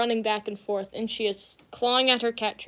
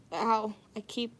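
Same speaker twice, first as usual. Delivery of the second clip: ordinary delivery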